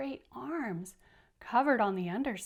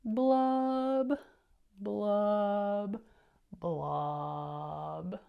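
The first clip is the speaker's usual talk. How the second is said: loud popping blubs, falling at the end; fish blub sounds; 4 dB louder, much slower than usual. Shown as a demonstration. Reader shows the class the fish's loud blubbing. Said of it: "how he does this part".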